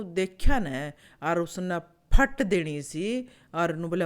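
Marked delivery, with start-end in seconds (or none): none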